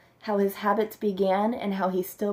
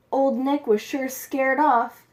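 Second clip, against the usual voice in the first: ordinary delivery, nothing marked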